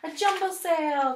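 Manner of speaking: an excited cry; cries. Acted. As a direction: call out the find happily. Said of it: "cries Harriet".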